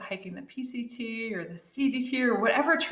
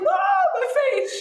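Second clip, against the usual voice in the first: high, loud wail of pain; 6 dB louder than usual. Retold or imitated. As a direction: cry out about your face, pitched high. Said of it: "I was like".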